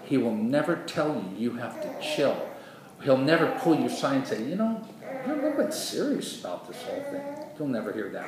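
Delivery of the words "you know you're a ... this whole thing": very high, quick put-on voice; light high-pitched mock advice; mock gentle chiding. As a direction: imitate a mild busybody, pitched way up. Voices advice God would never give.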